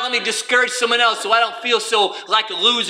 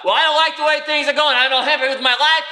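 raised, whiny complaint; mock angry complainer; 7 semitones higher than usual. Preacher voicing an angry churchgoer, whiny and raised. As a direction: voice a bitter man listing woes. Angry person voiced in sermon.

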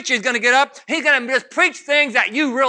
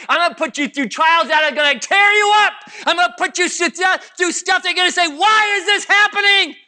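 higher, building preacher's shout; full-force preaching; 8 semitones higher, 4 dB louder than usual. Acted. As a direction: build the warning to a high cry.

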